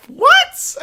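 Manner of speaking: high-pitched squeak of disbelief; What? jumps way up in total disbelief; incredulous squeak. Real, not acted. Disbelief at fan's anger.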